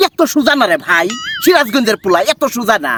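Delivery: indignant Sirajganj villager's accent; put-on Sirajganj accent, protesting; Sirajganj dialect. Shown as a demonstration. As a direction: scold someone in broad Sirajganj dialect. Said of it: "আমি সিরাজগঞ্জের ভাষা কই".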